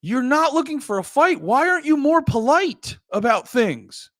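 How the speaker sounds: imitating someone fed up with the shouting; mock exasperated retort. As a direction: throw it back at them in a high mock voice. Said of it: "And you're like".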